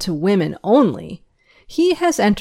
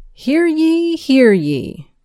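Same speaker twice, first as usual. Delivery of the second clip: town crier; 7 semitones higher, slower than usual. Acted. Opening call for school announcements.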